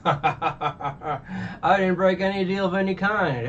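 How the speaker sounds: laughing villain, slow and pitched high; mock villain denial. Arlong denies breaking the deal to Nami, mock gloating. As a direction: play a gloating villain feigning innocence.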